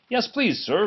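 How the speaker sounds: a hint of a small eager engine; cheerful young engine voice. Duck voiced in story.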